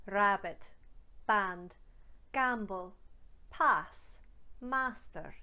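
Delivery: "Rabbit", "band", "gamble" with the open Scottish ah; Scottish ah vowel. Shown as a demonstration. Coach drills the Scottish ah. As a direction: open ah in "pass", "master". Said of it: "Let's go through a few more".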